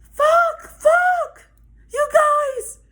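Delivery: loud, very high-pitched imitation of her panic; hammed-up hysterical girl; mock freak-out. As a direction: squeal it in bursts, way up high. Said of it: "She really freaking the fuck out".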